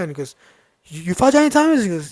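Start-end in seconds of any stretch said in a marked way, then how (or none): 0.8-1.8 high, loud, stammered question; stunned disbelief. Retold or imitated. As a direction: imitate an amazed guy double-checking. Rival repeats question in disbelief.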